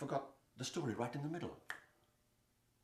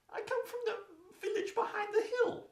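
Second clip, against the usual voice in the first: put-on timid girl. The girl answers Lion timidly.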